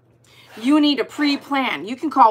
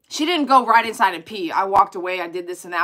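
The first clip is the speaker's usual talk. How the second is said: normal talking voice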